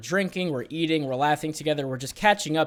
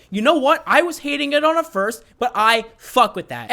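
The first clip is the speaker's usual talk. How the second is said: emphatic; 8 semitones higher, 7 dB louder than usual. Real, not acted. Emphatic approval again.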